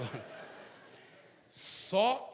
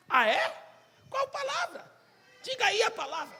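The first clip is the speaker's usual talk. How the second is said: much higher, mocking taunt; mocking squeal; 5 dB louder than usual. Student president sneers at Zé, high mocking taunt.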